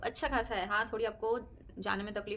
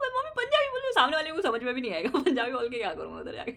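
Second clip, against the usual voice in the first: high, loud laughing protest; mock protest; 5 semitones higher, 8 dB louder than usual. Answering mother-in-law who wants Punjabi spoken, high laughing protest. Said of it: "मैं कहा".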